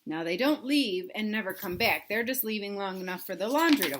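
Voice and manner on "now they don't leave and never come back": ordinary delivery, nothing marked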